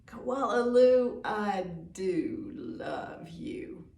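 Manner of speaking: slow, loving sing-along line; loving words for everyone to join; tender refrain. Shown as a demonstration. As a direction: lead the children in saying it tenderly. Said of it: "you can say it with me".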